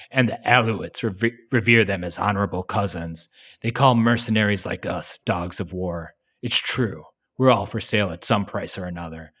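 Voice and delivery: lightly voiced stoic soldier; a hint of a hardened soldier, read out; Snake voice. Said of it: "I'll try to do a better Snake here".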